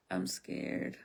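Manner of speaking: usual speaking voice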